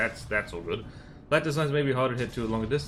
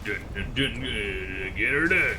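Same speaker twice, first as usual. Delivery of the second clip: put-on Southern hillbilly drawl; hammed-up American South accent. Streamer goofing while throwing a ship at commodities, southern US accent. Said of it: "I went southern United States there".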